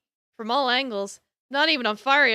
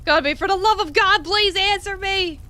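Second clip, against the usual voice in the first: desperate plea; 8 semitones higher, 4 dB louder than usual. Acted. Begging for an answer.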